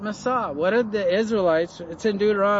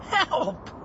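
mock cry for help; 7 semitones higher, 3 dB quieter than usual. Moses turns to God.

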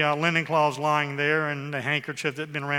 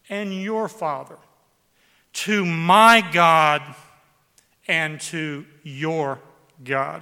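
slow emphatic reading; 8 dB louder, much slower than usual. Shown as a demonstration. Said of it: "Look at the scripture again that I read through quickly".

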